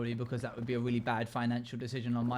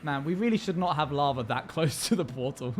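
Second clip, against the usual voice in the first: normal talking voice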